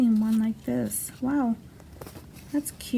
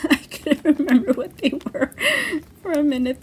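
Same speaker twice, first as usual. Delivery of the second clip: real laughter through the words, higher and louder; laughing; 6 semitones higher, 5 dB louder than usual. Real, not acted. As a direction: laugh at your own forgetfulness while talking. Laughs at her own forgetfulness.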